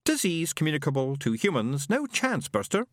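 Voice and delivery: pompous robot. A narrator's reading. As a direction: list it like a know-it-all robot. Robot Isaac speaks.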